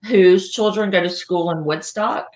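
ordinary delivery

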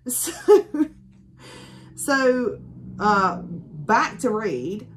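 normal talking voice

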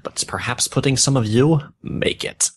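deadpan dramatic fanfiction reading; mock fanfic reading. Podcaster reading fanfiction to cohost, deadpan storytelling. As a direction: read the bad fanfic aloud with a straight face. Reads fanfiction aloud, mocking.

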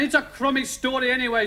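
high, stubborn storybook retort. Acted. Dougal rejecting the storyline, stubborn. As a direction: dig your heels in, in character. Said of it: "said Dougal stubbornly".

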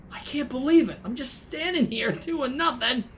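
normal talking voice

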